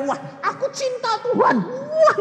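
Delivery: high, gushing mock enthusiasm; mocking a hotheaded believer's zeal; mock zealot. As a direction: parody an eager convert.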